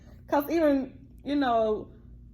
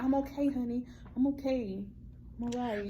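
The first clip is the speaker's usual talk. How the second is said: quiet, reassuring aside to a spouse; soft reassurance; 10 dB quieter than usual. Retold or imitated. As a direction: play it hushed, brushing off concern.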